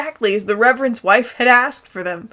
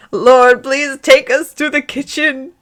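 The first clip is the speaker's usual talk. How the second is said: mock-booming preacher prayer, louder; bellowed prayer; 5 semitones higher, 4 dB louder than usual. Dumbledore bellowing a prayer to God, hammed-up loud. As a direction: call up to heaven like a preacher. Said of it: "bellowed in a voice even louder".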